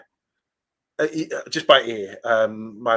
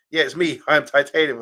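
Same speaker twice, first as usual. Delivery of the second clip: lightly voiced phone caller; surprise caller voice; 5 semitones higher, 5 dB louder than usual. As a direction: play a caller announcing himself. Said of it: "I'm like".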